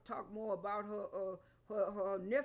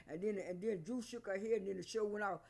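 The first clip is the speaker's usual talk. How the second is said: ordinary talk, nothing marked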